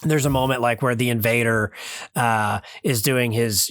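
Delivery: ordinary talk, nothing marked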